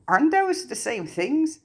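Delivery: high, puzzled question; confused. A narrator's reading. Harry puzzled by the goblin, high confused question. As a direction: ask it baffled, pitch up.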